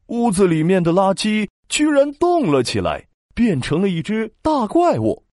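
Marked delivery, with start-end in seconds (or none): none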